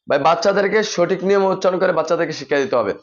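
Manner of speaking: ordinary delivery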